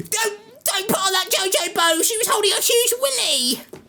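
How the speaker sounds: high-pitched panicked shriek, hammed-up; mock screaming parents. Vlogger mocking outraged parents, high mock shriek. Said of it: "Parents everywhere screaming".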